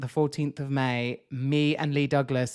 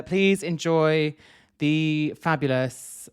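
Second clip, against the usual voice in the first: announcer intro; 2 semitones higher, 5 dB louder, slower than usual. Acted. Introducing the podcast guest.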